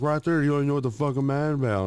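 fast, high imitated scolding; retold angry yelling. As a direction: rattle off his wife's accusation. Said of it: "she was yelling at me".

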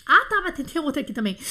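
usual speaking voice

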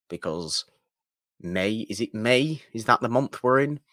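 normal talking voice

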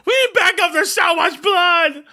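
high, frantic cry over the radio; walkie-talkie cop. Retold or imitated. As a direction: squeal it like a panicked radio call. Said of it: "you just hear over the walkie-talkie".

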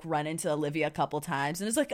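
ordinary delivery

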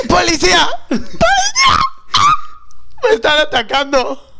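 high cry for the police, half laughing; woman screaming for a police officer; calling for help. Acted. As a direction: yell for the cop, breaking into laughter.

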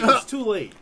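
loud, punchy declaration; loud emphatic. Real, not acted. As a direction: hit it hard and loud. First take, loud.

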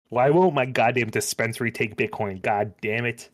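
ordinary talk, nothing marked

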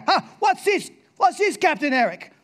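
put-on high, eager little boy asking; curious child voice. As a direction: play the curious kid, pitched high.

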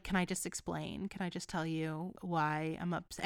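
quietly imitating a sincere plea; soft sincere plea. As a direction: gently ask to be heard. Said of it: "genuinely just like".

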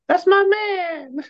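put-on high, slow swooning girl voice. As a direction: gush it like a girlfriend in love. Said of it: "you need to do your girl voice more often".

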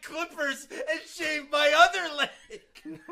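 slow, loud, laughing through it; laughing mock plan. Friend mocking his hair trick, laughing loudly. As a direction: ham up the absurd fix while cracking up.

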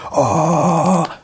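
loud high whoop; loud goading. Retold or imitated. Friends ramping up the chant, loud whoop. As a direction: build it up, yell it.

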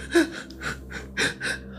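breathy shocked intake, way up; sharp high gasp. Retold or imitated. Retelling the moment he heard crying, gasping. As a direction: gasp as if just scared.

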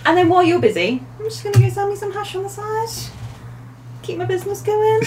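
high playful pimp voice; high put-on pimp voice. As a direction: pitch up into a cheeky hustler.

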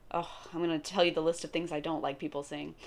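ordinary talk, nothing marked

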